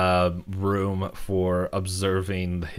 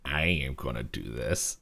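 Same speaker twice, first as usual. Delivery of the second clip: low, softer resolute voice; mock determination; 8 semitones lower, 6 dB quieter than usual. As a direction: drop low for a hammed-up vow. Mabel's determination, acted out.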